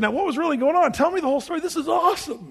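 high, eager excited questioning; having fun. Retold or imitated. Visitor begging the minister for the whole story, excited fun. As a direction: press for the story with giddy delight. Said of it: "having fun with the whole thing".

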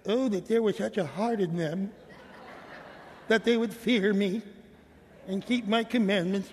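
a touch of a needy, approval-seeking voice; insecure needy God. Retold or imitated. Preacher mimics readers who hear God as insecure, mildly needy. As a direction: lightly play a God fishing for compliments. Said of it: "they read it like God is insecure".

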